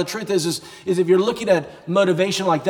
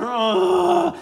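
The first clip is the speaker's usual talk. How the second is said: straining grunt; 13 semitones higher than usual. Retold or imitated. Brock straining under the weight.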